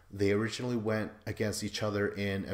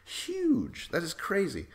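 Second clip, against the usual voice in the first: stunned; 8 semitones higher, slower than usual. Real, not acted. Stunned by the twist.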